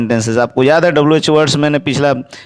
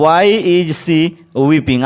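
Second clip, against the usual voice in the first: very slow, word by word, clearly spaced; slow dictation; much slower than usual. Shown as a demonstration. Teacher dictating to the class, very slow and clear. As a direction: spell it out slowly for students to write. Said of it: "teacher dictates the English sentence".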